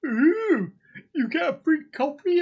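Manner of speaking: high, hammed-up cooing over a free coffee; playful high mock awe; mock posh delight. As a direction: gush like it's luxury. Mock excitement about free coffee.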